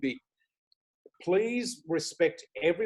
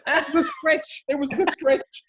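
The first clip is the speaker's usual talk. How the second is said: much higher, louder, laughing the line; 11 semitones higher, 5 dB louder, slower than usual. Real, not acted. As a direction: crack up on the punchline. Laughing at the bus joke.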